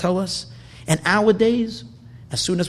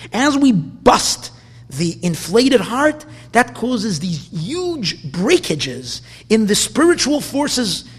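louder, higher, impassioned sermon voice; fiery preaching; 6 semitones higher, 6 dB louder than usual. Acted. Rabbi stirring his congregation toward repentance, preaching at full force. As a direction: preach it hard, hammering each phrase home.

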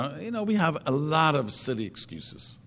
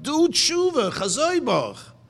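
urgent plea; 11 semitones higher, 3 dB louder, slower than usual. Acted. Hashem urging Yeravim to repent.

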